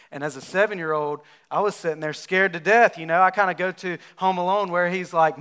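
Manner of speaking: usual speaking voice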